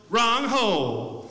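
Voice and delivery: slow, drawn-out scornful put-down; a slow, cutting mock sneer; mean put-down. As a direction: drag it out to sting. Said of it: "you say it like that 'cause it's, it's mean".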